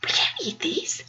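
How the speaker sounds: hushed, unvoiced warning; whispered warning. Roommate warning Alita about the cookie, whispering. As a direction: whisper it slowly like a secret. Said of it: "she was like".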